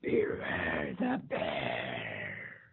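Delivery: very low, very slow growl; deep slow bear growl; growly bear voice. Acted. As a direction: drag it out like a looming bear. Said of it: "a great growly voice that called".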